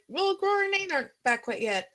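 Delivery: high-pitched character line; light, high in-character reply; quoted character voice. Acted. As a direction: lift the pitch well up to play her. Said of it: "and she's like".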